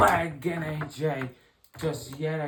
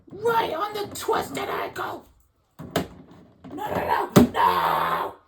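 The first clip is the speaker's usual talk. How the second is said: frantic outraged yelling. Acted. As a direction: yell in horror as the attack goes on. Commentator horrified by renewed attack.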